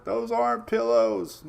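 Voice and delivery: high, slowed-down film quote; movie line quote. Acted. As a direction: quote the comedy line in mock alarm, drawn out. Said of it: "I was reminded of Planes, Trains, and Automobiles".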